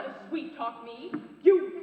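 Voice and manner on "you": loud cut-off yell; angry shout. Acted. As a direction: start a furious shout. Cut off mid-attack on rival.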